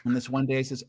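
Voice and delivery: plain reading voice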